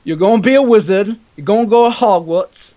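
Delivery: high, loud mock English accent, Hagrid style; bad English accent. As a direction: ham up a terrible English accent like a Harry Potter spoof. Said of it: "I had the worst English accent".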